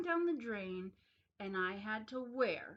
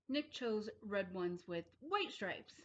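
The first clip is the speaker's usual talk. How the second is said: ordinary delivery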